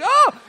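loud, high frightened "oh"; startled yelp. Retold or imitated. Dad caught out by the dinosaur, startled yelp. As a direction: yelp as if a monster jumped out. I'm kind of afraid.

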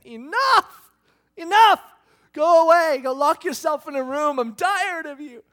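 loud, high shout of a fed-up parent; exasperated outburst. Acted. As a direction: blow up and send them away, dragging out the last words. God imagined losing patience with people.

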